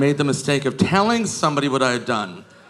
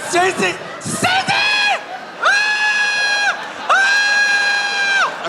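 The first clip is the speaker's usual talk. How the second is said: shrieking name, climbing ever higher into a scream; screaming reunion; much slower than usual. Retold or imitated. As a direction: scream like drunk friends meeting at a bar. Drunk girls reunite screaming.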